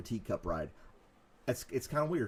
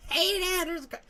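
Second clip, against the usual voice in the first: high-pitched mock child calling out; excited kid. Kid telling dad about car in tree, high-pitched. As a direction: squeak it like a kid alarmed.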